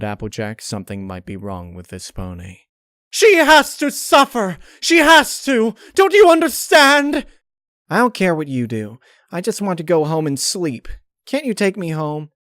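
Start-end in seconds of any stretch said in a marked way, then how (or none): 3.1-7.2 much higher, much louder frenzied shriek; furious hysterical outburst; unhinged screaming. Acted. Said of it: "got that look in her eye again". 7.9-12.3 higher, tired little pony voice; drained, listless mare; weary Pinkie voice. Acted. Pinkie's voice in story.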